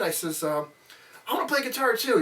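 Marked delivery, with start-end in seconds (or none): 1.3-2.2 put-on high eager kid voice; imitating young self. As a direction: beg Dad like an excited little boy. Child pleading to father.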